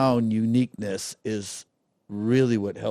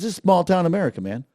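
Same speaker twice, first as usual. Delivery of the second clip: retold store lady; 6 semitones higher, 3 dB louder, faster than usual. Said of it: "She goes".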